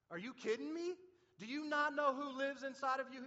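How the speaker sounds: high, loud disbelieving cry; incredulous shout. Retold or imitated. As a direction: throw the question back in disbelief. Preacher voices Bill's retort.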